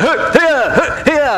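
high sharp hiya yells; karate kiai. Retold or imitated. Pastor acting the boy's kiai, high sharp yelps. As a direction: yell hiya like a kid striking a board. Boy karate-chopping a board.

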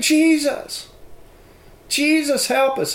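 high, slow child's voice imitating a young girl; retold daughter calling out to the family; daughter's voice. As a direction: speak as the nine-year-old daughter, urgent. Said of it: "And she said".

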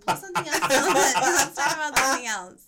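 loud, laughing plea; laughing protest. Real, not acted. Host begging to change the subject, laughing loudly. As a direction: burst out laughing through the words.

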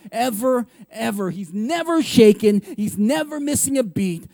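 normal talking voice

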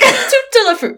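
high, silly fake French; mock French. Cohost joking about ruined French accents, mock French. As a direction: toss off nonsense in a French accent.

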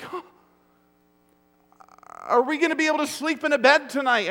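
put-on high, loud, rushed panicky child; mock panicked kid. Preacher imitating his kids freaking out, fast and panicky. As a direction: gabble it like a frightened kid. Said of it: "if they were freaking out like".

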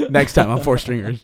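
a hint of a show announcer; mock announcer. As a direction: tease it like a TV promo.

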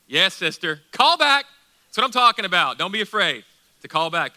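loud, high cheering encouragement; excited shout. Real, not acted. Preacher urges a responsive sister and congregation on, excited shout. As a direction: shout it out, peaking on "Call back". Answering a congregant's call back.

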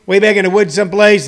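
ordinary talk

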